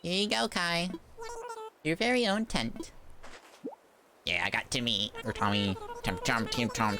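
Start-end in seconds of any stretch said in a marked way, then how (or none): 0.0-2.9 higher-pitched game character voice. Acted. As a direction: read it high as a character handing over a gift. Voices game character handing tent.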